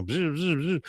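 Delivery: soft buzzing engine zips; quick quiet vroom noises made by mouth; imitated motor buzz. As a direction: buzz like a little motor zooming by. Imitates jet ski zipping about.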